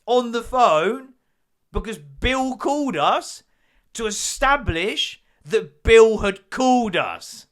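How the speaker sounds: exasperated recap. Acted. To the audience about Bill's call, slow loud exasperation. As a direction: spell out the absurdity.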